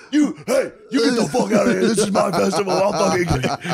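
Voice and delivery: high, ranting imitation; mock angry yell. Festival host ejecting a heckler, mock furious rant.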